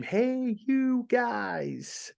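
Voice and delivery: high-pitched, drawn-out "Hey, you guys"; Rita Moreno scream. Retold or imitated. Host imitating Rita Moreno's TV-show call, high and drawn out. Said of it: "have her just scream".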